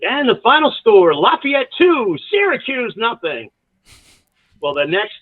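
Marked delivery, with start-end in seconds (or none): 0.0-3.5 mock PA final-score call, high and drawn out; stadium announcer. Announcer reading the final score to the team, high, slow announcer call. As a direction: boom it out like a game announcer, stretching the team names. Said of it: "I brought in my announcer from Stockton".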